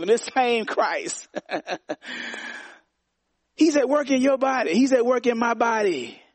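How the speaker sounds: slow, high, ringing preacher's cry; preaching at full force. Acted. Pastor preaching to the church, ringing and slow. Preaching about the risen Christ.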